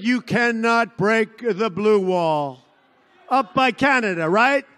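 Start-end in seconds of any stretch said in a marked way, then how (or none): 0.0-2.6 slow mocking quote. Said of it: "they said".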